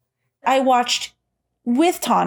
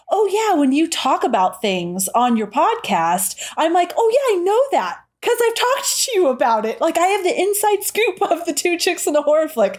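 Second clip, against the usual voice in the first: higher-pitched gushing quote of an eager fan; excited fan; 8 semitones higher than usual. Retold or imitated. Coworker retelling how the fan reacts to the podcast, excited. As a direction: gush it brightly, pitched up. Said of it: "she's like".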